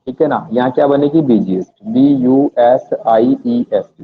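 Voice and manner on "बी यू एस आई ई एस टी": slow letter-by-letter spelling. Shown as a demonstration. Teacher dictating spelling to students, slow. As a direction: spell each letter out slowly, pausing. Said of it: "spelling busiest slowly".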